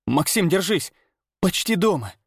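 high, urgent encouragement. A narrator's reading. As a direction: urge him to hang on. Friend urges him to hold on.

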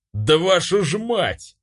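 narrated outburst of fury; furious yell. A narrator's reading. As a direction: curse in rage, pitch rising. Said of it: "бешено заорал я".

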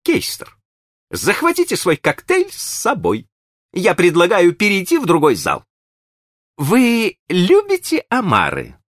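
high, bright eager host voice; cheerful high gentleman. A narrator's reading. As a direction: gush over an old friend, high. Green leads.

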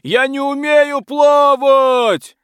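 very high, loud, slow cry; panicked scream. Acted. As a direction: wail in panic in the water. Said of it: "кричал рыцарь".

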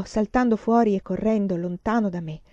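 plain reading voice

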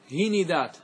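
هندات as hi-ni-dat; three syllables in هندات, "i" twice; vowel-echo plural. Shown as a demonstration. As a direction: copy the "i" onto the ن in هندات. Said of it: "هذا إتباع".

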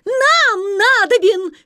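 high, slow eager shout. Acted. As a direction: call out together. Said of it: "кричали русалки".

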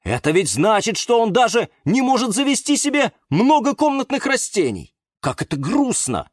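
high, dismayed lament; pitying cry from a little houseplant; dismayed plant friend. A narrator's reading. Houseplants pity someone without plants, high dismayed voice.

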